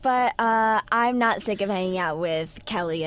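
ordinary talk, nothing marked

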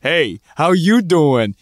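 slow, drawn-out Joey pickup line; milk the catchphrase as Joey; Joey impression. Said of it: "Can I do my Joey?".

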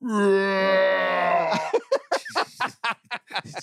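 long, high howl breaking into laughter; loud laughing wail. Real, not acted. Host cracking up at the slow-motion joke, loud howl. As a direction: let out a drawn-out high cackle.

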